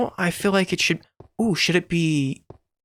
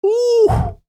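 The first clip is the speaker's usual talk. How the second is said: delighted ooh; 3 dB louder than usual. Real, not acted. Admiring the build.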